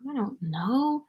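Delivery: soft breathy whisper, unsure. Real, not acted. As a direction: whisper it to yourself, puzzled. Player whispers while thinking.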